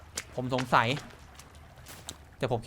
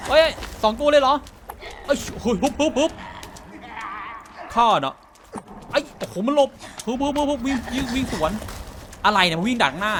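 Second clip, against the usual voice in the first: panicked yelling; 6 semitones higher than usual. Real, not acted. Fighting two cannibals in the cave, yelling in panic. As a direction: yelp and shout while fighting off attackers. Cannibals attack during play.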